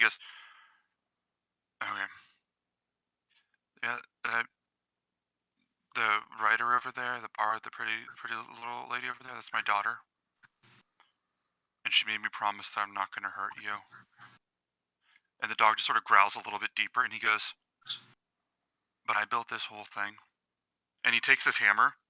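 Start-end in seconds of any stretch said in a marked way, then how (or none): 1.8-13.8 quiet confrontation. Acted. Father quietly warning a guard dog about his promise, hushed. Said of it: "he says it real quiet". 19.0-20.2 soft, subdued in-character voice; quiet confrontation. Acted. Smith facing down a growling dog, quietly firm. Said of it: "he says it real quiet".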